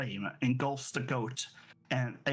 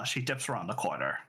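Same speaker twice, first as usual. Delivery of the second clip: ordinary talk, nothing marked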